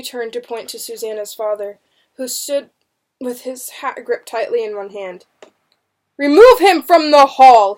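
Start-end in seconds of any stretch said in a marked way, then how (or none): 6.2-7.7 loud, raised, furious order; angry shout. A narrator's reading. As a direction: shout the order across the hall. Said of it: "he shouted angrily".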